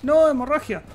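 loud, high cry of alarm; dismayed cry. Real, not acted. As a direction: blurt it out as you get hurt. Gets wounded in game fight.

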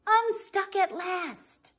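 high, slow, joyful release; relieved cheer as truck gets free; triumphant. Acted. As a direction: savor the victory. Triumphant story climax.

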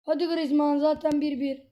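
ordinary talk, nothing marked

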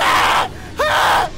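high, loud panicked gasps and cries; real terrified high-pitched panic. Real, not acted. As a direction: squeal in terror. Panic while fleeing monster.

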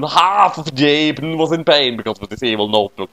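usual speaking voice

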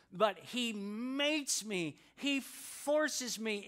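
slow, weighted makes and forces; stress on makes. Acted. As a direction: dwell on makes, then forces. Pastor stresses key word.

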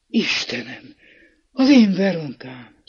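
a sudden glad exclamation, rising loud at the end; startled cry. A narrator's reading. Old priest recognising his sister, crying out in surprise. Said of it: "aztán felkiáltott".

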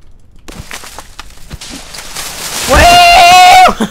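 a loud, high shriek of laughter; high startled laugh. Real, not acted. Gamer cracking up at a jump scare, loud high laugh.

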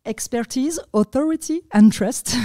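self-mocking English accent on the English terms. Shown as a demonstration. Guest jokes about her English accent, reading Google's criteria in English accent. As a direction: say the English words with a show-off English accent. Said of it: "attention à mon super accent anglais".

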